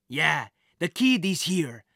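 slow, lightly voiced thug on the phone; a hint of a gruff henchman reporting in; villain on the phone. A narrator's reading. Carl reporting to his boss, lightly voiced thug. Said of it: "Carl said".

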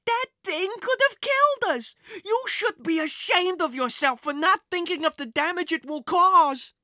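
angry mother's high-pitched scolding; Missus Eberly shouting. A narrator's reading. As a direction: scold your son, building to a loud finish. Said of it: "Missus Eberly shouted".

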